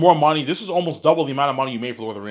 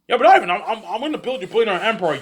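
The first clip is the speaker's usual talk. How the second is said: [name]'s loud mock protest; 7 semitones higher, 4 dB louder than usual.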